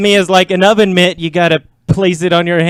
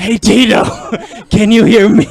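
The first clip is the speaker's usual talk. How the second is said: giggly prank whisper; 3 semitones higher, 5 dB louder than usual. Pretend. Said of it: "is the whispering into the mic".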